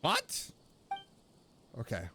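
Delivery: normal talking voice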